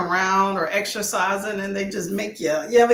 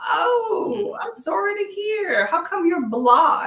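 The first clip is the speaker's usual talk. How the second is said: high, slow, teary-sounding sympathy; comforting a viewer who feels blah; sympathetic; 8 semitones higher, slower than usual. Real, not acted. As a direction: coo over them with a sob in the voice.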